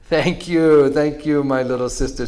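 loud, high thankful cry; joyful shout. Acted. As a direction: burst out with gratitude. Healed buffalo rejoices.

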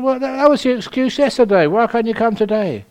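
high, fast, exasperated questioning; pushy caller. Retold or imitated. Caller refusing the nun's excuse, high, fast and exasperated. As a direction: hurry the demand like an annoyed caller. Caller pressing busy nun again.

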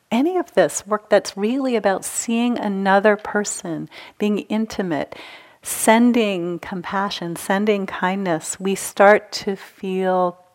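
usual speaking voice

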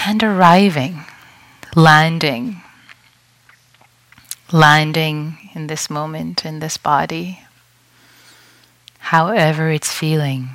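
unhurried meditation guide with long pauses; guided meditation. Real, not acted. Teacher guiding retreatants to settle and breathe, guided meditation voice. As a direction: lead them gently into stillness, leaving space between phrases.

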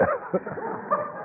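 airy, unvoiced burst of laughter; high breathless laugh. Real, not acted. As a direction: laugh silently at the punchline. Laugh at the punchline.

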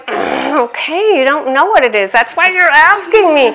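high, loud exasperated explaining; pleading with a stubborn child, voice climbing; exasperated aunt. Retold or imitated. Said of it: "it was like".

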